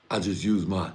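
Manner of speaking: ordinary talk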